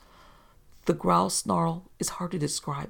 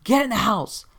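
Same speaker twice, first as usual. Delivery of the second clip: raised, louder shout of alarm; yelled order; 5 semitones higher, 6 dB louder than usual. A narrator's reading. Dad to narrator after the growl in the yard, yelling. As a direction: yell it as an urgent command. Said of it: "and he yelled".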